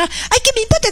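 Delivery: high dismissive "ay, ¿qué me importa?"; defiant daughter. Retold or imitated. Preacher mocks a daughter brushing off her father, high and dismissive. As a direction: toss it off high and careless, mocking.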